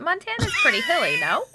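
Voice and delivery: loud and quick; excited. Real, not acted. Teasing challenge, shouted.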